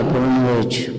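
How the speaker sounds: low grumpy goodnight. Retold or imitated. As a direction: drop it low and dry, a sulky man. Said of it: "Aí ele fala". (boa noite bem grave e seco, marido emburrado)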